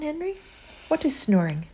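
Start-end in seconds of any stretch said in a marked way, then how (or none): none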